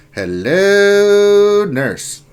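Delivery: high, loud, stretched-out hello; cheeky hello nurse. Pretend. A streamer greeting a viewer who just arrived, with a loud cheeky leer. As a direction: leer it out playfully.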